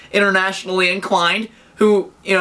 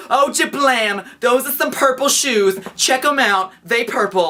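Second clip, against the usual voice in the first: slightly higher, slowed goofy showoff voice; a lightly put-on silly voice to show off shoes; weird voice; 5 semitones higher, slower than usual. Showing his purple shoes, a lightly silly voice. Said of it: "in that weird voice".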